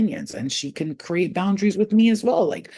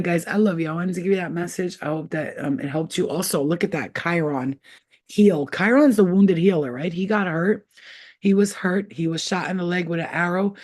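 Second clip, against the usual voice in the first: usual speaking voice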